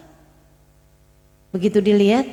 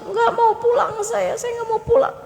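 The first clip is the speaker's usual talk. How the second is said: high, whining mock cry; imitated tearful refusal; 10 semitones higher than usual. Old man begs his driver to stay, tearful pleading.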